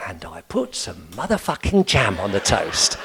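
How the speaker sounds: put-on old man calmly repeating the swear back; old man's calm echo. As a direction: answer the outburst deadpan. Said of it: "So he'd be there going".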